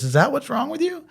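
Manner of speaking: wife scoffing at her husband's sulk; incredulous wife. Retold or imitated. Wife incredulous at his sulking.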